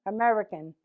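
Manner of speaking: normal talking voice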